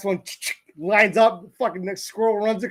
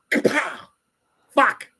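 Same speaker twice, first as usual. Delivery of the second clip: mock gunshot and curse; 8 semitones higher, slower than usual. Imitating dad firing the old rifle, high mock yell. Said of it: "He's like".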